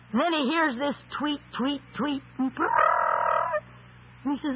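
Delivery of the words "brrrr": imitated bird warble, low rolled brrrr; bird trill.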